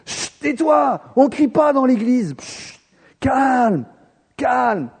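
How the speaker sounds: shushing scold. Retold or imitated. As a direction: hush him down like an annoyed disciple. Disciples hush the shouting blind man.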